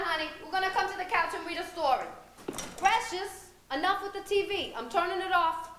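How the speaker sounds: put-on a scolding mother calling her child, voice lifted a little. Mother nagging Precious to tidy up, mildly scolding.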